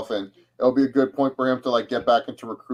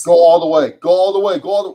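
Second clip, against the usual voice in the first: loud, higher-pitched urging at the play; excited cheering; 4 semitones higher, 12 dB louder than usual. Real, not acted. Fan urging on a kick returner, excited shout. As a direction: shout the runner onward.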